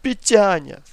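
high, loud anguished wail, names sung out; screaming lament. Acted. Grandmother crying out her children's names in a nightmare. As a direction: sing out the children's names, thin and pained. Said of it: "и закричала".

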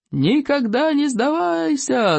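high, drawn-out rallying chant; gleeful yelling. A narrator's reading. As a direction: holler it slowly like a pleased gang. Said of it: "заорали довольные хулиганы". (протяжный радостный клич)

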